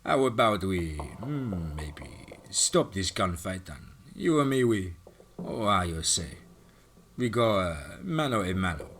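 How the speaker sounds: put-on slow, halting Russian accent groping for words; thick Russian accent. Russian villain challenging Hawk to a knife fight in a Russian accent. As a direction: challenge him in a Russian accent, hunting for the phrase. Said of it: "Kachinko scowled at Hawk and said".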